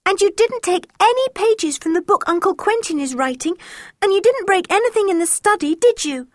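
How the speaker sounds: put-on young girl voice. A narrator's reading. Anne voiced higher as girl.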